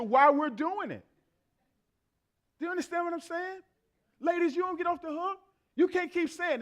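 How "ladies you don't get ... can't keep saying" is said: ordinary talk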